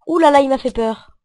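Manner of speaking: scared. Real, not acted. Startled by enemy in game.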